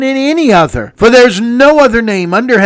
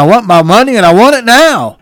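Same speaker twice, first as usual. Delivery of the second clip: louder mock commercial pitchman; imitating ad voice; 3 semitones higher, 7 dB louder than usual. Preacher quoting a TV commercial, mock pitchman shout. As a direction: ham up the TV ad line. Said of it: "Like that commercial".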